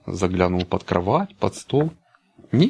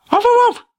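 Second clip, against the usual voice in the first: high loud yapping bark; puppy barking; 6 dB louder than usual. A narrator's reading. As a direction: yap like a little puppy. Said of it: "залаял щенок". (щенячий лай)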